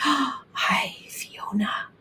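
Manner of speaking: remember her with longing; hushed, wistful voice; wistfully. A narrator's reading. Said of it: "father said wistfully".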